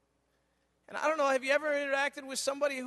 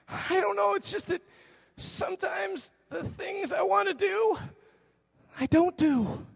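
put-on higher-pitched, halting child voice; confessing little boy; 7 semitones higher than usual. Son explaining his attitude to father, childlike halting confession. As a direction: imitate a son struggling to explain himself.

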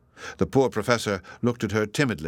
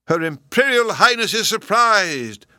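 much higher, louder, slow proclamation; announcing; 11 semitones higher, 6 dB louder than usual. A narrator's reading. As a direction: announce it grandly to the room. Professor announcing to attendants.

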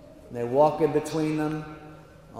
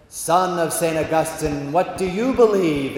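God the Father; 5 dB louder than usual. Acted. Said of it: "and he says".

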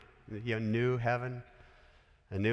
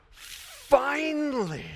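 long-drawn, high "finally"; imagined relief; 9 semitones higher, much slower than usual. Pretend. Preacher imagining John seeing the promise fulfilled, exclaiming. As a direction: stretch it out as a hammed-up sigh of at-last. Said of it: "I kinda think John was going".

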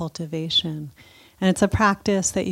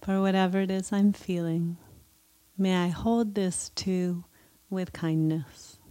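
guided meditation; 2 semitones higher than usual. Acted. Leads a loving kindness meditation.

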